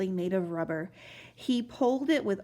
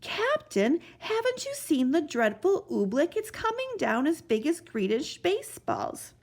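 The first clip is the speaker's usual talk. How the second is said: put-on worried boy; 6 semitones higher than usual. Boy warns.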